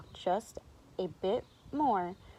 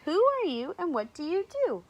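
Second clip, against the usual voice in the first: zookeeper character; 8 semitones higher, 4 dB louder, faster than usual. A narrator's reading. Zookeeper questions the cow.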